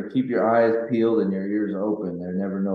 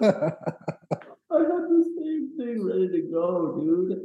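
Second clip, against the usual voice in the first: higher, slow, laughing disbelief; 10 semitones higher, slower than usual. Real, not acted. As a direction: laugh through the words. Laughing at coincidence.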